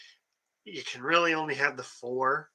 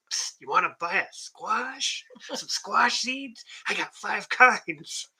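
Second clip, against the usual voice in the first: slow, higher hushed street peddler; secretive raspy sales murmur; 7 semitones higher, much slower than usual. Retold or imitated. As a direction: sidle up and sell it on the sly. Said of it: "that one sounded a little scary".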